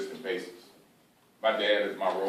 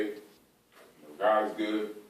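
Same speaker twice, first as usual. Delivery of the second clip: normal talking voice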